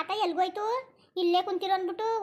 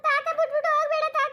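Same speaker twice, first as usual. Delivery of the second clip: put-on high, tearful child begging; a little girl crying for grandpa to stay; crying plea; 10 semitones higher, 3 dB louder than usual. Granddaughter begs grandfather not to leave her, in a high crying voice.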